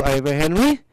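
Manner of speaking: ordinary delivery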